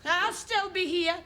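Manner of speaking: high, desperate call after a departing customer; calling after her. Acted. Lonely shopkeeper calling after a leaving customer, high and pleading. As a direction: shout it after her as she leaves.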